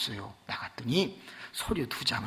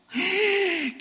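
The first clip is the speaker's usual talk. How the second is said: gasp; 3 dB louder than usual. Retold or imitated.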